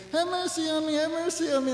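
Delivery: mock begging cry; pleading beggar.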